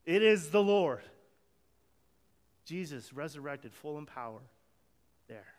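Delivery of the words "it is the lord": "It is the Lord" called out louder, with the emphasis on "is"; a touch of a joyful shout; retold shout. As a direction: call it out with an exclamation point, no doubt in your mind. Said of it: "And he yells out".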